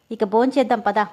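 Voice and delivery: usual speaking voice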